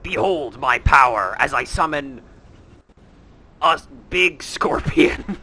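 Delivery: loud, slow grand summoning voice; pompous booming ogre mage. Acted.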